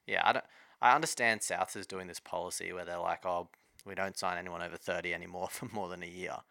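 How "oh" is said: ordinary talk, nothing marked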